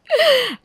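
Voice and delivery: short, very high teasing giggle; mean little high laugh at the bride; mocking giggle. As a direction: giggle at her expense. Sisters-in-law mocking the bride.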